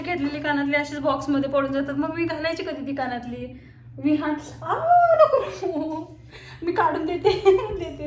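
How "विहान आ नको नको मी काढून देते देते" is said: real laughter through the words, a bit higher and louder; laughing. Real, not acted. Baby grabs her earrings, laughing.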